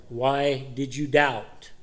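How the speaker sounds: slow, deliberate question; penetrating question. Acted. Jesus asks Peter the penetrating question, slow and deliberate. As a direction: let each word land, probing. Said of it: "So then the penetrating question".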